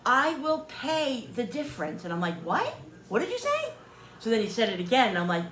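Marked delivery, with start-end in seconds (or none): 0.0-2.0 higher, emphatic imitation of exasperation; frustrated insistence. Woman mimics seller finally getting through, frustrated. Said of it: "got so frustrated with me". 2.4-3.7 much higher, rising double take; startled disbelief. Retold or imitated. Customer retells hearing the offer at last, startled. Retold sudden realization.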